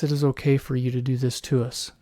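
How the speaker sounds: normal talking voice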